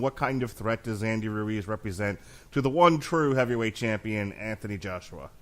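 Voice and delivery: ordinary delivery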